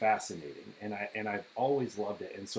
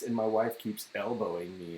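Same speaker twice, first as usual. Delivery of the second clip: ordinary talk, nothing marked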